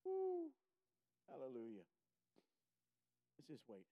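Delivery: ordinary talk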